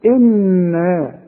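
إنا with a long nasal hum on the doubled نون; nasalized nun. Shown as a demonstration. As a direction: hold the ghunna in إنا. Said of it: "كل نون عليها شدة لازم تغن".

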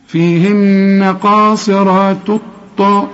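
slow chant, "قاصرات" joining straight onto a doubled heavy T; merged lam. Shown as a demonstration. Teacher shows the assimilation, slow chanted join. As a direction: drop the l of "الطاء" into a held T while chanting slowly. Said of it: "وأدغمت".

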